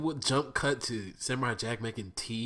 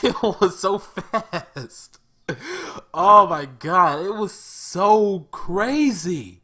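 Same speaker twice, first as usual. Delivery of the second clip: high, loud, slow and stretched amazement; excited laughing rave; 10 semitones higher, 15 dB louder, much slower than usual. Real, not acted. Telling viewers how wild the scene was, in loud slow awe. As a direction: drag every word out in awe. Raving over action scene.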